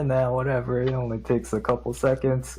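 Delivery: ordinary talk, nothing marked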